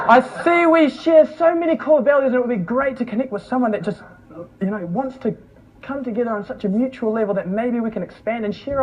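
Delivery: high, gushing mock networking pitch; stilted opening building to a high-pitched spoof; over-keen networker. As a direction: send up an overly earnest online message. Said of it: "messages from people saying".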